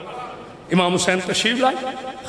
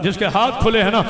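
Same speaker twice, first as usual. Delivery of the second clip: ordinary delivery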